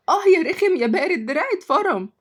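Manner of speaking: high, mildly squealed complaint; pained cry. A narrator's reading. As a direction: yelp crossly as if pinched. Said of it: "وصرخت شهد".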